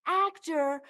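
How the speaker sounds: slow, high re-enacted astonishment; amazed outcry. Moviegoer announcing his discovery to the cinema, high slow amazement. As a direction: stretch it out high in disbelief. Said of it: "And I went".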